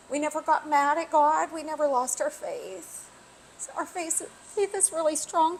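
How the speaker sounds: fighting back tears; after crying. Real, not acted. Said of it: "I really didn't think I was gonna get teary".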